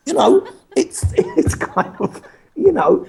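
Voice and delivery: talking through real laughter; laughing. Real, not acted. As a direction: giggle through the words. Laughing about exploding microwave scene.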